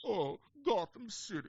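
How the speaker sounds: whiny sad clown Bane. Retold or imitated. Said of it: "He sounded like a sad clown".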